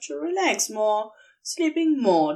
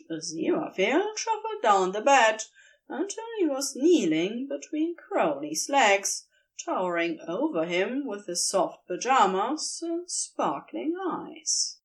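plain reading voice

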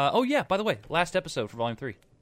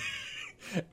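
laugh; 10 dB quieter than usual. Real, not acted. Laughs at the road rage joke.

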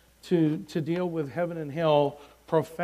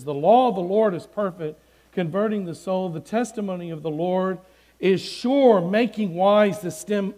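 preached scripture reading; 3 semitones higher, 8 dB louder than usual. Acted. Preacher reading a Psalm to listeners during testimony, declaimed. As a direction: proclaim each verse with ringing emphasis and deliberate pauses.

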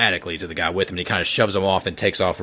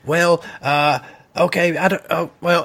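much higher, stammering agent; much higher, stammering character voice; flustered Davis; 9 semitones higher than usual. Acted. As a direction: play Davis lost for words.

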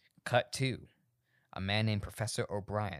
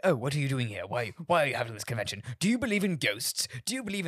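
mock British reporter, quick and pitched up; British accent; 3 semitones higher than usual. Podcaster imitating British press, mock British accent. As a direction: ask it like a nosy British journalist. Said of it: "But, like, British people".

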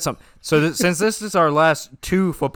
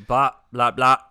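each blah chopped apart, blah... blah... blah; broken-down delivery; 3 semitones lower, 3 dB louder, slower than usual. Retold or imitated. Co-host mocking the segue that wasn't smooth, choppy blahs. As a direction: hit every BLAH separately, not smooth. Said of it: "You broke it down".

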